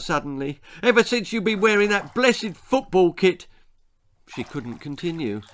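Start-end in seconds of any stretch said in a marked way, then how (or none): 0.8-3.5 high, tearful and a little louder; a mother near tears, voice rising; upset mother close to crying. A narrator's reading. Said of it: "left the room in floods of tears".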